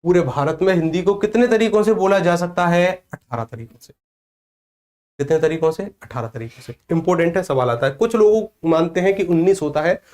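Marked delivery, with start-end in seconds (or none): 3.1-3.9 low soft answer. Shown as a demonstration. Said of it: "teacher answers own quiz". 6.0-6.7 low soft answer. Shown as a demonstration. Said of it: "teacher answers own quiz".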